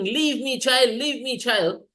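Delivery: high-pitched mock scream at ordinary volume; retold scream. Preacher retells Putana begging to be released, mildly mock screaming. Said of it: "when Putana was screaming".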